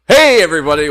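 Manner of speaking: big high hello, everybody; loud greeting. Real, not acted. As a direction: boom the show opener. Podcast opening greeting.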